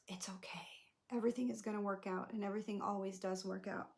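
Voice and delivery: soft unvoiced whisper to the inner child; whispered reassurance. Speaker whispering comfort to her inner child, gentle whisper. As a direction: hush it like soothing a child.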